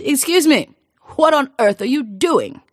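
baffled call. Acted. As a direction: demand an answer from a madman. King baffled by roadside fisherman.

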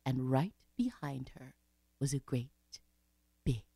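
low, soft narration fading to near whisper; hushed suspense. A narrator's reading. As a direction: hush it, leaving long pauses. Suspenseful discovery of snake.

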